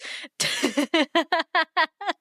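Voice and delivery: a bright, high burst of laughter at her own joke; laughing. Real, not acted. Laughing at her own wiretap joke, loud laugh.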